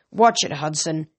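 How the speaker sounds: ordinary delivery, nothing marked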